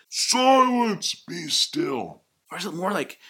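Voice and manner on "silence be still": booming, high-pitched command; commanding loud voice. Shown as a demonstration. Jesus rebuking wind and waves, loud commanding shout. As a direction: bellow an order, stretching the first word. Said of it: "this commanding, uh, loud voice of".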